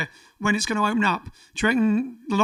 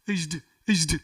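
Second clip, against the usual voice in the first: mock stutter; 4 dB louder than usual. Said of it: "if there will be stuttering".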